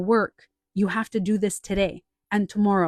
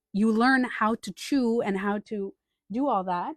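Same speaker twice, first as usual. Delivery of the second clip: ordinary delivery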